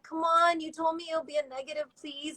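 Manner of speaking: high-pitched mock begging; retold pleading. Pleading with the nurse in retelling.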